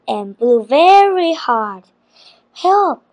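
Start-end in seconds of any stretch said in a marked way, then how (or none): 2.5-3.0 higher-pitched call for help. A narrator's reading. Narrator voices Kamar calling for help in a storm, calling out. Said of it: "called Kamar".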